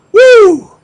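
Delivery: high loud sarcastic woo; mock cheer. Preacher mocking pride over beating Slovenia, sarcastic cheer. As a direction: cheer a petty win with irony. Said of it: "celebrating beating Slovenia".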